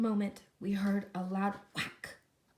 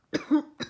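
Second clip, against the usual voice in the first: acted cough; 4 semitones higher, 9 dB louder than usual. Lorax coughs from smog.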